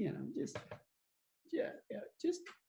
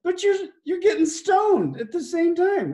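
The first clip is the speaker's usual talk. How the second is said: friend's amazed retort; 10 semitones higher, 6 dB louder than usual. Retold or imitated. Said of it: "And he goes".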